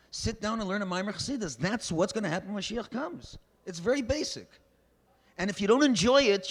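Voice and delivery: high, loud pulpit exhortation; fiery preaching. Acted. Rabbi exhorting his congregation, fiery preaching. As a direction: drive the point home to the congregation.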